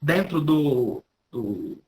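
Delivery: ordinary delivery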